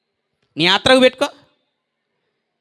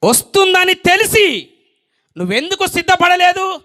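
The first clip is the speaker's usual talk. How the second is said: forceful confrontation; 5 semitones higher, 3 dB louder than usual. Real, not acted. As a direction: press the accusing question home with a raised voice. Preacher confronts the unprepared listener.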